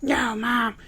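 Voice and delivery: very high mock heroic declaration; hammed-up teen defiance; cheesy TV-movie rebel; after-school special hero. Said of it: "Eli's like".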